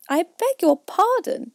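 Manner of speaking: high, slow, stiffly polite; frowning politeness. A narrator's reading. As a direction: frown but keep it courteous. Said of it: "frowning, but very politely".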